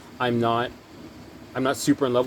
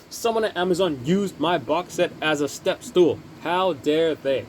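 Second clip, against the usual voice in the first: higher, hammed-up indignation, slowing right down on how dare they; mock outrage; 6 semitones higher, slower than usual. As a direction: play the wounded collector, half joking. Box set damaged, mock outrage.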